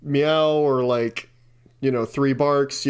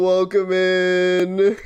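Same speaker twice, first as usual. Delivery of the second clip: drawn-out welcome; 2 semitones higher, much slower than usual. Real, not acted. Drawn-out greeting.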